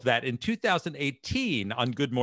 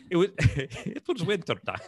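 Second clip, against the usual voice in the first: quoting in a Russian-sounding accent; Irish try that drifts into Russian accent; 4 semitones higher than usual. Shown as a demonstration. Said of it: "That's like a Russian accent".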